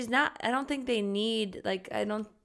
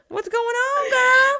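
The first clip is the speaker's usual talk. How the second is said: high, loud squeal of disbelief; mock incredulous; 9 dB louder, slower than usual. Podcaster replaying shock at freezer hoarder, high mock disbelief. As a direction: pitch it way up, playfully scandalised.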